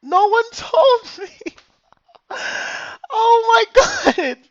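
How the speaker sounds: high, slow, loud laughing sob; laugh-crying. Real, not acted. Streamer laugh-crying that no one told him.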